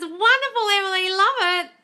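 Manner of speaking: high, loud, bright praise; gushing praise. Real, not acted. Coach praising a viewer by name, high and loud. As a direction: cheer her on, way up high.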